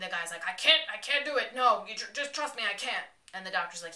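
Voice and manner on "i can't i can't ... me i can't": loud, high frantic refusal; retold frantic back-and-forth; mock panic. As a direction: act out a panicked argument, loudly. Retold panicked argument over cutting arm.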